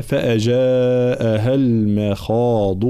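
slow chant with long madd; Quran recitation. Acted. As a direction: stretch the long vowels in measured recitation. (ترتيل قرآني)

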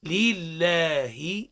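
لله drawn out very slowly, lam doubled and vowels clear; teacher leads the class, stretching لله; slow model recitation. Shown as a demonstration. Teacher models لله very slowly for the students. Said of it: "هاك تواصل سيدي".

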